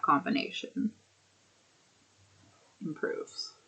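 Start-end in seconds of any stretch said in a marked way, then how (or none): 2.8-3.5 whispered. Real, not acted. Trailing whisper.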